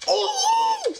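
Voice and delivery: panicked high screaming; being chased. Real, not acted. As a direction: shriek while running away.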